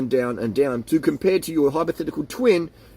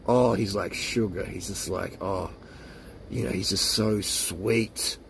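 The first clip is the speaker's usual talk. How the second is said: low, soft, slow lovestruck sigh; dreamy gushing; 8 semitones lower, 7 dB quieter, slower than usual. Pretend. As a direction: swoon over him like a smitten lover. Imagined lover gushing about sugar.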